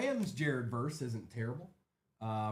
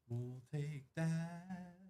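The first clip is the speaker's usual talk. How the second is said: drawn-out sing-song; 4 semitones higher, 6 dB quieter, much slower than usual. Real, not acted. Card break host repeating catchphrase.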